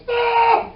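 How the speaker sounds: high, very loud held shriek; sudden piercing yell as the car; car's alarmed cry. Acted. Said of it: "when the car goes".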